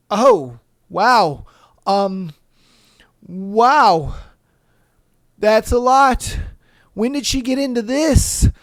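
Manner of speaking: loud, halting wow with pauses; hammed-up shocked mother; mock stunned mom. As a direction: take it in, stunned. Mom voiced in retold story.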